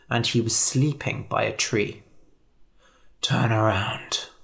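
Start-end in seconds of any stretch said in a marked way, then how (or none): 3.2-4.3 unvoiced quiet whisper. A narrator's reading. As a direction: breathe it to not wake the wolf. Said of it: "said the hunter quietly".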